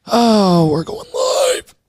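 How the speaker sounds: hammed-up going live call; mock announcer. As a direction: ham it up like a showman.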